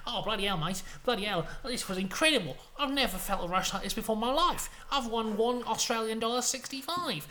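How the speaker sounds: hammed-up gambler's elation; on a rush. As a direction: gush with mounting excitement. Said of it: "he's on a rush".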